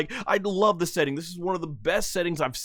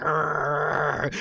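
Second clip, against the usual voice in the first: low monster roar; angry growl; 5 semitones lower than usual. Retold or imitated. Fan voicing angry Goma, a low growl. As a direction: growl like an enraged giant. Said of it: "He's like".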